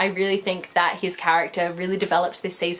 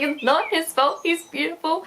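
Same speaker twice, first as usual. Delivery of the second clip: much higher, dreamy gushing defence; swooning fangirl; 8 semitones higher than usual. Retold or imitated. Defending Steve to mum, high smitten gush. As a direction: gush over him like a smitten fan. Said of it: "And I was like".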